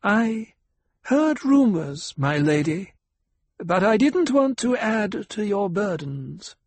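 high-pitched, hesitant doctor's voice; high, halting deference to a lady; deferential doctor. Acted. Doctor's voice in narrated story.

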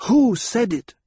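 loud, high insistence; fierce repeat. A narrator's reading. Hera demanding who insulted Aliyah, loud and high. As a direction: press the question hard. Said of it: "Hera repeated".

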